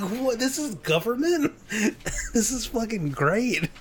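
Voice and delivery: high, laughing disbelief; amused disbelief. Retold or imitated. Watching Commons videos, high laughing disbelief. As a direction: delight at the absurd spectacle. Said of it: "I'm like".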